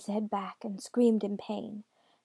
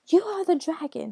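high, loud shout; shouted accusation; 7 semitones higher, 8 dB louder than usual. A narrator's reading. Daughter accusing her father, shouted high and loud. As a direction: shout it as an accusation, pitch raised. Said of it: "she shouted".

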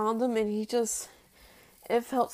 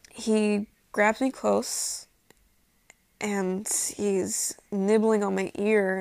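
normal talking voice

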